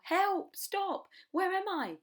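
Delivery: very high, loud and dazed; panicked cries. Acted. Dazed crocodile cries for help, very high and loud. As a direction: cry out tumbling in panic.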